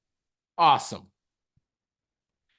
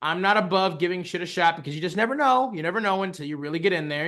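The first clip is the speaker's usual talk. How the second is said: ordinary delivery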